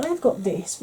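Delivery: usual speaking voice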